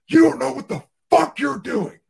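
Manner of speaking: furious surgeon. Retold or imitated. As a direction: tear into the rep like an enraged surgeon. Surgeon berating rep in OR.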